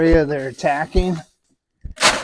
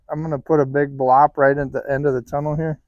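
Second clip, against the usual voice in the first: ordinary delivery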